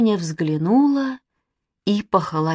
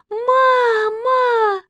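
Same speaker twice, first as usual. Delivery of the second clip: very high, long wail; tearful high-pitched shriek; terrified scream; 4 dB louder than usual. A narrator's reading. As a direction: cry out for mother. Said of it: "закричала Катя".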